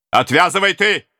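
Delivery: stern order. Acted. Prince orders Khlopko, axe raised.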